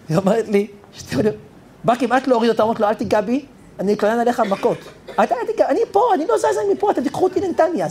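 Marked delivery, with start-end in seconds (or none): 5.2-7.9 put-on high, protesting old lady voice; old woman protesting. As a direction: refuse to budge in a high old woman's pitch. Old woman resists the inspector. (זקנה מוחה בקול גבוה)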